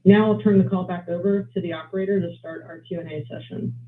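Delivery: usual speaking voice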